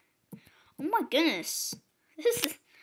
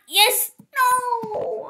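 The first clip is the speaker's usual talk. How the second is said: excited outburst; 11 semitones higher, 6 dB louder than usual. Real, not acted.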